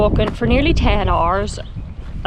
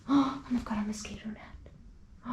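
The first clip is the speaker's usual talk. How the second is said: much softer, breathless with wonder; awed, hushed discovery; gasping whisper; 15 dB quieter than usual. Real, not acted. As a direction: whisper it after a gasp.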